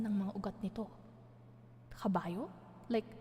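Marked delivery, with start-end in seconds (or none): none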